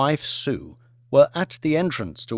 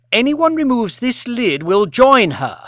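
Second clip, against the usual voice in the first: higher and louder, imperious warning; icy threat; 9 semitones higher, 6 dB louder than usual. Acted. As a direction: lay down the law to the whole kitchen. Lady Elizabeth threatens kitchen staff.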